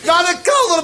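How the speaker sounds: high, mock-frantic bellow; roaring order. Grandpa ordering everyone to the cellar, roaring. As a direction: roar the command like an old patriarch. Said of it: "brulde opa".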